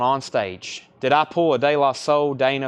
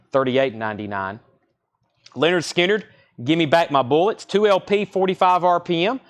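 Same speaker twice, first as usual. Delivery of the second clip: ordinary talk, nothing marked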